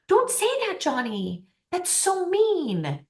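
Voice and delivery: hammed-up mum telling off her boy; scolding mother. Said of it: "his mother, that comes along and goes".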